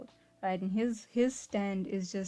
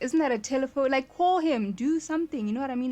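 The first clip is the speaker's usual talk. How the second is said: louder, higher exasperated urging; yelling at the screen; 5 semitones higher, 6 dB louder than usual. Pretend. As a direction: shout at a character who won't act.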